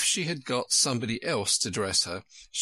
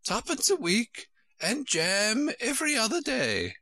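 very high-pitched storybook queen; high Queen correcting Alice; fussy Queen; 11 semitones higher than usual. A narrator's reading. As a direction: put on a high, querulous royal voice.

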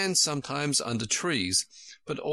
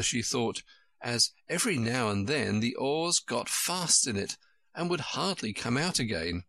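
ordinary delivery

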